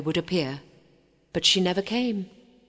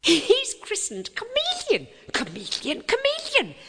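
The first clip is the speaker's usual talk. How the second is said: put-on much higher, excited chattering girls; chattering maidens. A narrator's reading. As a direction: squeal it like gossiping maids. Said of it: "chattered the serving maidens".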